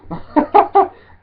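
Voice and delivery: high, loud burst of laughter; laughing at himself. Real, not acted. Man amused by his failed British accent, loud high laughing. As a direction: crack up loudly at your own attempt.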